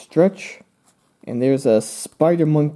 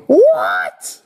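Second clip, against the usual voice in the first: loud, acted shock; freaked out; 4 semitones lower, 5 dB louder than usual. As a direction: blurt it in mock disbelief. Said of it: "I'm obviously freaked out".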